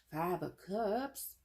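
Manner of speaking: high, drawn-out mock pity; put-on silly voice. Reader revealing a sad card, silly put-on voice. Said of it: "making all these voices".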